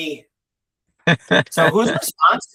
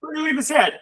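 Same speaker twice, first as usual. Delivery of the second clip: high excited voice; 14 semitones higher, faster than usual. Acted. Admiring hat, high voice.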